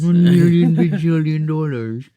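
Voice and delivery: hammed-up greedy shout; a big theatrical money demand; mock greedy boast.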